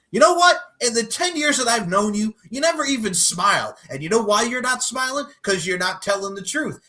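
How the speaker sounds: high, loud, mocking president voice; sneering at her in a hammed-up president voice. President taunting the journalist, high mocking voice. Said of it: "And he goes".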